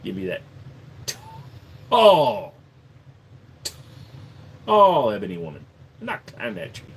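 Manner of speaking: lightly put-on African accent. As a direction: lean low and savour the word tall. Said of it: "Can you do anything African?".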